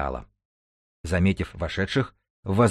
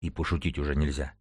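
low, soft, near-whispered complaint; subdued, lower and quieter aside; hushed grumble; 4 semitones lower, 7 dB quieter than usual. A narrator's reading. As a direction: grumble it under your breath. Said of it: "Шёпот Клайда".

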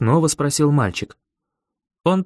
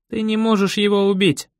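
put-on higher, level boy's voice; boy character; 6 semitones higher than usual. A narrator's reading. Boy answering the narrator back, higher boyish voice. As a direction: pitch it up for the boy.

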